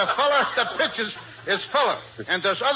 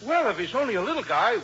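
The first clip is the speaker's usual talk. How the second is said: usual speaking voice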